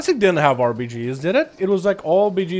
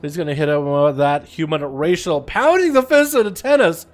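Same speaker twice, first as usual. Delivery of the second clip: play-by-play; 3 semitones higher, 4 dB louder than usual. Acted. Casting the arena match.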